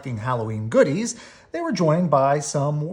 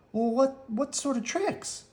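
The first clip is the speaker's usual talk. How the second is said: nervous stammer; 12 semitones higher, 3 dB quieter than usual. A narrator's reading.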